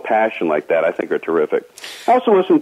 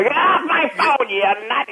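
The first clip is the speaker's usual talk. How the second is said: high sputtering exasperated bark; sputtering foiled villain, high and squeaky; Joe Flynn impression; 13 semitones higher than usual. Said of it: "he's doing Joe Flynn".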